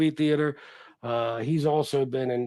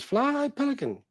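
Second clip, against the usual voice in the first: wife's retort; 11 semitones higher than usual. Retold or imitated. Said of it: "And she says".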